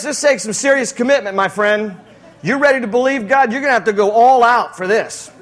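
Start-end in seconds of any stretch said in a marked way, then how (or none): none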